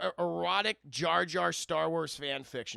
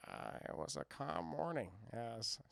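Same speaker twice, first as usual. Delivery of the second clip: George Lucas voice; 9 semitones lower, 11 dB quieter than usual. Retold or imitated. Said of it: "in the George Lucas voice".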